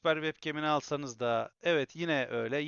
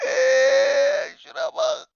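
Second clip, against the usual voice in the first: excited squeal; 13 dB louder, much slower than usual. Real, not acted. Thrilled by camera focus.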